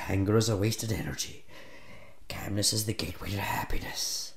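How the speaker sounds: low, subdued recitation; chastened, quiet anger-management mantra; calming mantra. Acted. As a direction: recite it softly to cool down. Recites calming exercise after rant.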